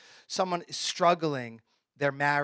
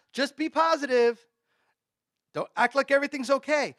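mock positivity; 6 semitones higher than usual. Mocks advice he disagrees with.

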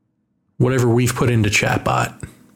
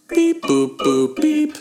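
robot beeps; slower than usual. Pretend. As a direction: chirp it like a little robot. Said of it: "Let me put it in scientific terms".

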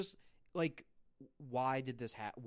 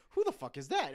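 shocked reaction; 6 semitones higher, 8 dB louder than usual. Retold or imitated. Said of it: "you're like".

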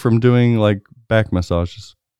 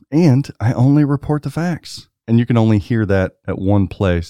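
ordinary talk

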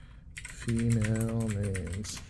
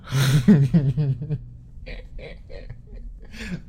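laugh; 5 semitones higher, 4 dB louder than usual. Real, not acted.

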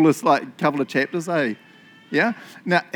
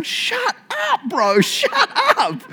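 high, slow, strangled cry; drawn-out high-pitched comic protest; mock desperate plea; 4 dB louder, slower than usual. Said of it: "I'm imagining Haman going".

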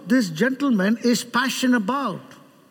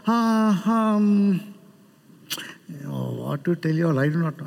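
hesitant, weary fumbling; imitated listless pastor; 4 semitones lower than usual. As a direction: pretend to have nothing to say, sinking low. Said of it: "Have you ever seen him saying that?".

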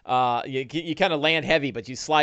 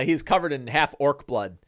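normal talking voice

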